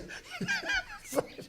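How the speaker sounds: shrieking high laugh; real delighted laugh; high burst of laughter. Real, not acted. Laughing at host's pun.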